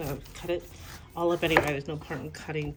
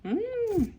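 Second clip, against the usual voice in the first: delighted mmm; 4 dB louder than usual. Real, not acted. Tasting sweet pineapple.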